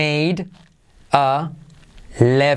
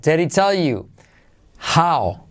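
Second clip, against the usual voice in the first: ordinary talk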